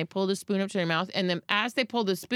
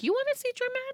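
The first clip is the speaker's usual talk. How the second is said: higher mock challenge; challenging; 4 dB quieter, faster than usual. Mother retorts when called dramatic, mock challenge. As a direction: dare them to see drama. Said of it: "And then I'm like".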